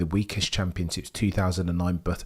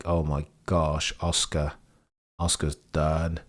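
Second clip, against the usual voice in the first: deflated dismay; 5 semitones lower, 4 dB quieter, slower than usual. Real, not acted. Dismay after Oscar's mistake.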